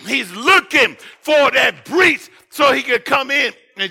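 loud, rising preacher's cry building on each phrase; a pastor driving the warning home, in full preaching force. Acted. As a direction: preach it out to the back pews.

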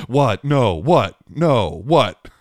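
high, loud mock-startled kid; startled disbelief. Son to dad about a bonus round, startled disbelief. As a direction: imitate a balking kid, each word jumping up loudly.

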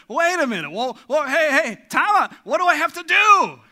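a panicked objector yelling to be heard; legalist's outburst. Retold or imitated. Legalist protesting grace to the preacher, shouting. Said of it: "the legalist said".

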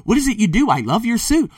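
gushing fan. Retold or imitated. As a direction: squeal it like an over-eager admirer. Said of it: "They're gonna say".

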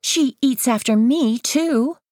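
put-on high, insistent girl voice; a little girl insisting. A narrator's reading. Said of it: "Kayla insists".

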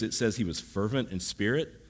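ordinary delivery

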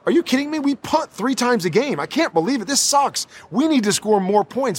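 scoffing as if the praise were absurd; exasperated disbelief. Retold or imitated. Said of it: "I would look back at them going".

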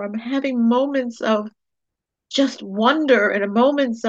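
choked up with gratitude, holding it together; getting emotional. Real, not acted. Host telling podcast listeners her thanks, teary and moved. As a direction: voice lifted and welling up with tears. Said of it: "I'm getting emotional".

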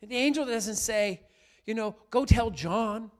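loud, ringing sermon voice; preaching at full force. Acted. Pastor preaching loudly to the congregation. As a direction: preach it out to the whole church.

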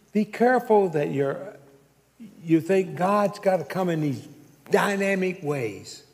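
ordinary talk, nothing marked

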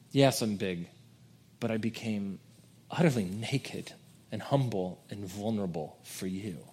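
hushed, sincere, giving; tender divine voice. Acted. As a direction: speak it gently as God to his people. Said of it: "No, he says".

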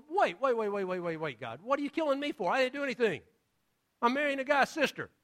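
a king begging God not to kill him; panicked Abimelech. Retold or imitated. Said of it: "And he's going".

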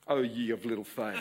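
playful, amused retort; laughing tease. Acted. Daughter teasing mother.

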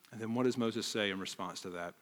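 ordinary delivery

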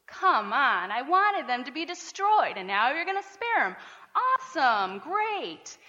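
mock-annoyed sarcastic complaint, slow on seriously; exasperated Jonah. Preacher voicing Jonah griping to God, mildly exasperated. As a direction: play a sulky prophet griping at God. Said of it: "how Jonah is feeling".

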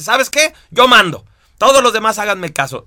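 playful mock commander taking charge; bossy gland. Lecturer voicing the dominant gland, bossy and loud.

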